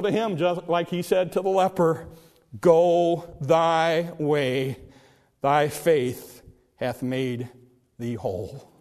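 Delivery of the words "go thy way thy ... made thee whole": very slow, low, solemn blessing; Jesus. Retold or imitated. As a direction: draw it out, sinking softer to the end. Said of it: "And Jesus said".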